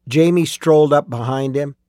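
ordinary delivery

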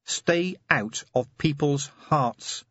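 slow growling warning; growled. A narrator's reading. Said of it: "she growled".

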